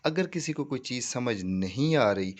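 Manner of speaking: ordinary delivery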